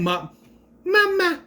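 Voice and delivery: mock the grown man's "Mama?" in a high whine. Recapper mocks a thirty-five-year-old man saying "Mama?", in a high whine. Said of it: "Why are you saying".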